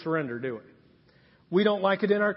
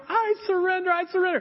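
mock frantic surrender; 10 semitones higher, faster than usual. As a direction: blurt it out like a kid begging to be let go. Pinned boy gives in.

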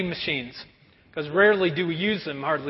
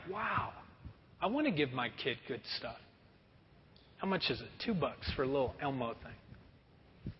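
low, soft resigned murmur; giving-in parent; 6 semitones lower, 13 dB quieter than usual. Retold or imitated. Tired parent gives in to kid, soft and low. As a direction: sigh it like a worn-out parent. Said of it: "they're like".

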